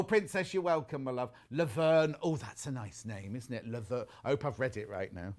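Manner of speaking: normal talking voice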